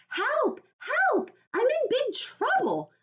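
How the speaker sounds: put-on high, loud little girl yelling for help; Junie B. yelling. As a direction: cry for help like a panicked kid. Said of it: "I yelled to Mother".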